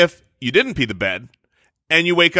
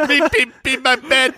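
put-on high toddler voice, laughing; giggly little kid baby talk; 9 semitones higher than usual. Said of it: "Is this how you spoke?".

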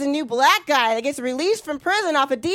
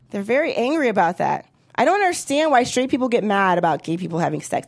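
ordinary delivery

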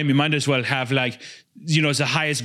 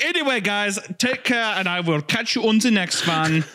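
youtuber outro voice; 7 semitones higher than usual. Retold or imitated. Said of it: "He's like".

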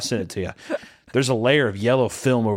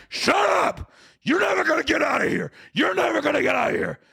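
high, screamed threats; captors' furious shouting. Acted. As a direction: shriek it at a caged prisoner. Captors shouting at caged prisoner.

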